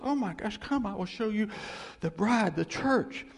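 high, eager announcement; excited. Acted. God presenting the church as bride, excited. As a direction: show off the bride, thrilled. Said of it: "You know, he's excited".

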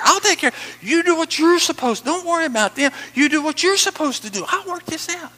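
high, insistent, exasperated-sounding instruction; God's voice. Acted. Preacher voicing God telling him what to do, high and insistent. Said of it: "he told me what to do. He says".

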